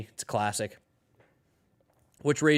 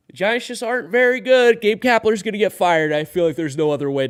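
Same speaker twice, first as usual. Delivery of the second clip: higher, louder declaration; ringing call, much higher and louder; loud emphatic; 7 semitones higher, 8 dB louder than usual. Real, not acted. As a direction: proclaim it like a bold hot take. Emphatic hot take.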